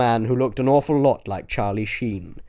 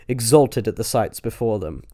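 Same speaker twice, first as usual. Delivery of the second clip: ordinary delivery, nothing marked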